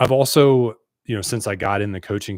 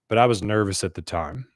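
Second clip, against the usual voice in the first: normal talking voice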